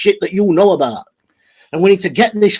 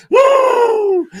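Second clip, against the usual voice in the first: whoop. Real, not acted. Reacting to chat, mocking.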